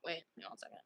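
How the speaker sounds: very soft, whispery aside; barely audible; hushed aside. Real, not acted. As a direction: drop to a near whisper. Quiet aside mid-story.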